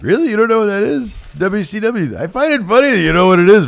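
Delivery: high incredulous "You don't know?"; mock exasperated son. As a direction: needle your old man loudly. Imitating Triple H to Vince.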